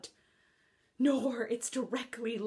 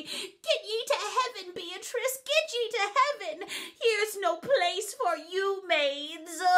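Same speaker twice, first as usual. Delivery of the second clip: high, laughing devil voice; lightly voiced gleeful devil; mock devil; 9 semitones higher than usual. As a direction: shoo the maid off, laughing. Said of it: "and say".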